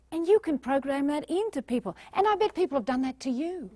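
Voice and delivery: normal talking voice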